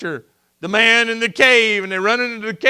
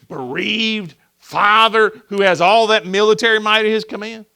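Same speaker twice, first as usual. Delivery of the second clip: ordinary delivery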